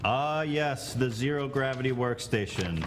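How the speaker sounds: pompous mock-royal lord; megalomaniac rant. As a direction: lord addressing his subjects. Mock lord holding court.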